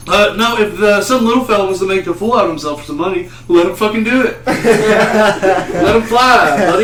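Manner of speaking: hammed-up good ol' boy defending it; Florida guy.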